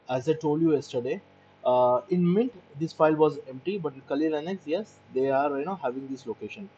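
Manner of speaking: usual speaking voice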